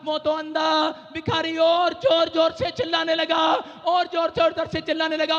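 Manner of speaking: loud slowed preaching; preaching at full force. Acted. Preacher telling the crowd of the blind beggar, loud. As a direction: build the shout of the story.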